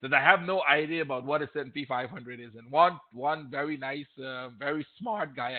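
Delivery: ordinary delivery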